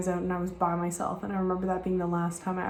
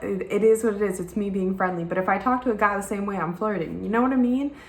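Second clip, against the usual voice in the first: usual speaking voice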